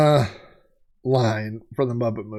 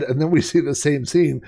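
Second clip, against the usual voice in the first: usual speaking voice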